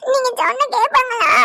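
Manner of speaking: put-on a small boy pleading not to eat, half sobbing; whining child. Child voice continues. (சாப்பிட மாட்டேன் என்று அழும் சிறுவன் குரல்)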